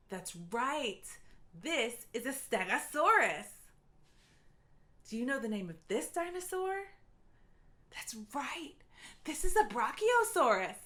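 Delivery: plain reading voice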